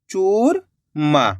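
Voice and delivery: "चूरमा" split slowly, long चूर, pause, then मा; drawn-out syllables. Shown as a demonstration. Teacher completing the word for learners, "churma" sounded out slowly in two parts.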